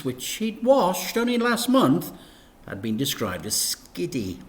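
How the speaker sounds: usual speaking voice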